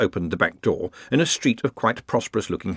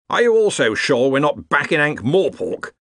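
higher-pitched, fairly loud question; loud challenge; 6 semitones higher, 4 dB louder than usual. A narrator's reading. Ridcully questions Ponder in the alley, loudly. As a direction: project it a little as a challenge. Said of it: "said Ridcully loudly".

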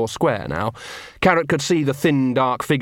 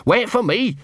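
higher, mildly barked call from the dog; 11 semitones higher than usual. Acted. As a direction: snap it after her like a small dog. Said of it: "barked Gaspode".

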